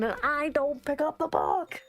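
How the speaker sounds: high-pitched indignant wail; exasperated outburst. Real, not acted. Player upset the book stays shut, exasperated outburst. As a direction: break from reading into a frustrated protest. Player objects while reading narration.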